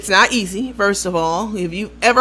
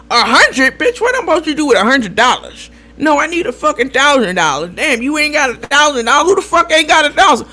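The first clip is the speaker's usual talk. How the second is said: mock indignation; 5 semitones higher, 4 dB louder than usual. Borrower scorning a hundred-dollar offer, mock indignant. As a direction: imitate an affronted borrower, pitched up.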